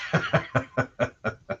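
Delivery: breathy amused laugh of a jolly father; dad's gleeful chuckle. Retold or imitated. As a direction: chuckle at the kid's sandwich. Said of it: "gleeful demeanor".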